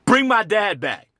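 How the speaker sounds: high, loud, worked-up pleading; anguished challenge. Acted. Son challenging sympathizers to bring his dad back, loud anguish. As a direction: throw it back at them, still high and loud. Acted bitter plea after outburst.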